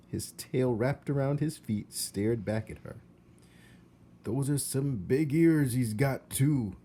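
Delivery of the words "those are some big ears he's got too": louder gossiping uncle voice; Uncle Alfred. Acted. Uncle remarking on ears, loud gossipy voice. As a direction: chew over the nephew's ears. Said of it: "observed his Uncle Alfred".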